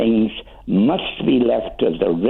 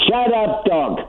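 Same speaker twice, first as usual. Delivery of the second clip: sharp high yell at the barking dog; shout at dog; 11 semitones higher than usual. Real, not acted. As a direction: bark the order over the noise. Owner yells at barking dog.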